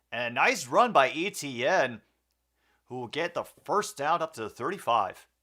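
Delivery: energetic sportscaster call; play-by-play commentary. Acted. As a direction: call it like a live broadcast. Calls the game play-by-play.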